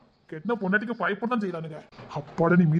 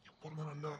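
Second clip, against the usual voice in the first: soft murmur; 3 semitones lower, 18 dB quieter than usual. Acted. Husband coaxing wife softly.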